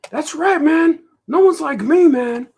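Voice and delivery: high, loud boastful yell; critic yelling. Acted. The critic character brags to the cartoonist, high loud yell. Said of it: "he has to yell at me all the time".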